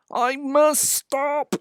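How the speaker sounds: desperate. A narrator's reading. Thomas skidding to buffers.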